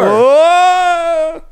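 high loud fake cry; mock cry. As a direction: wail it as a joke.

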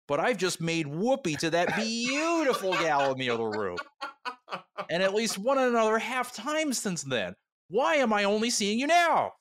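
panicked man demanding answers; agitated Sheridan. Acted. Sheridan voiced, upset.

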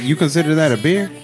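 usual speaking voice

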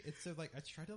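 normal talking voice

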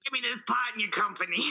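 quick put-on audition pitch; auditioning spin doctor. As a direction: demand the part in a salesman voice. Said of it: "every time you went into audition".

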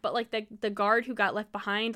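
usual speaking voice

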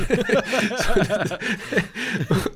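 long high burst of laughter. Real, not acted. Laughing at the surgeon story. (hohes, langes Lachen)

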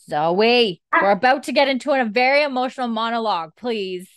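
high, loud, hurried scolding; exasperated plea. Real, not acted. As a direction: call out to the pets, pleading for cooperation. Scolding noisy pets mid-recap.

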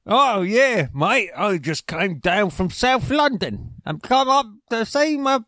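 high, hammed-up cockney; cockney accent. American pretending to be a cockney sweep, hammed-up accent. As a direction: play a Mary Poppins chimney sweep. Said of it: "if you go to London".

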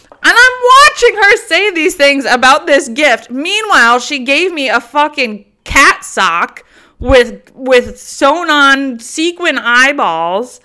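loud, high-pitched indignation played for laughs; incredulous outburst. Real, not acted. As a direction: burst out in comic outrage, slowing hard at the end. Incredulous outburst at the double standard.

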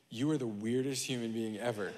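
low, soft deadpan verdict; imitated quiet disbelief. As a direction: quote a wife's understated judgment. Retells wife's verdict on him.

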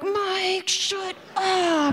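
high, drawn-out silent-scream imitation; mock exasperated plea. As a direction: plead through it in a high strained mock voice. Pleading aside to salesman, acted.

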